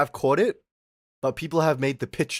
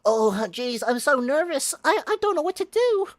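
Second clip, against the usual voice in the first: nervous date voice; 13 semitones higher than usual. Retold or imitated. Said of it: "You're like".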